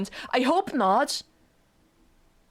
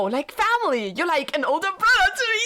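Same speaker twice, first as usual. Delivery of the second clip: dismayed outburst; 7 semitones higher than usual. Real, not acted. Streamer dreads brother-zone line in game.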